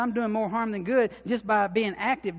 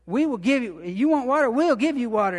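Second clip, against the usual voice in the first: loud, raised-pitch frustrated retort; angry outburst; 3 semitones higher, 7 dB louder than usual. Retold or imitated. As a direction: snap it back like a fed-up leader. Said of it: "he was so mad at them".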